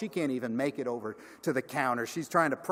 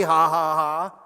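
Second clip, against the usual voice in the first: mock laughter; 2 semitones higher, 10 dB louder than usual. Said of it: "joined the laughter".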